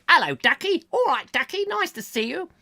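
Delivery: high, lilting mock Queen; sing-song greeting from a jolly Queen; Queen greeting Harry. Queen greeting Harry, high mock royal lilt. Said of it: "I wonder if the Queen says that to Harry".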